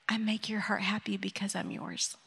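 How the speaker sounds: soft whispered affirmation; hushed closing affirmation. Real, not acted. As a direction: let it fall to a whisper. Closing her devotional thought.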